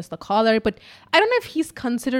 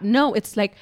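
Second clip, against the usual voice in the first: ordinary delivery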